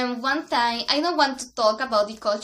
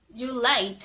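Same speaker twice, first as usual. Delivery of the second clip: ordinary delivery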